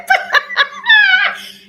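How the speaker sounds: high, loud burst of laughter. Real, not acted. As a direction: let a big laugh out. Laughing at her own quip.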